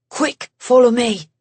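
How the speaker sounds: lightly voiced English-accented man, urgent; a hint of an English accent, hurried; light English accent, quick command. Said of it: "that English accent of his".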